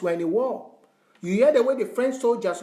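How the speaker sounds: normal talking voice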